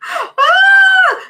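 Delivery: high, loud drawn-out "ah"; sudden loud high squeal, put on for a joke; mock scream. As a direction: let out a playful high wail. Mock startle while joking about wide eyes.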